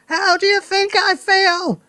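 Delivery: high, loud witchy rant; grandma yelling about becoming a midget; furious yell. Acted. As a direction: rage like a shrunken old crone. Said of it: "she yelled".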